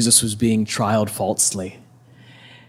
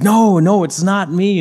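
much higher, louder acted protest; insistent denial; 9 semitones higher, 7 dB louder than usual. Disciple denying Jesus to his accusers, insistent denial. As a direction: imitate someone hotly denying it. Peter denies again under pressure.

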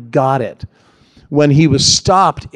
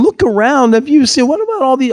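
much higher mock-incredulous jeer; 10 semitones higher than usual. Mocking crowd jeering at Jesus, high mock-incredulous jeer. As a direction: scoff as if he is a fool. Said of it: "they're just mocking him".

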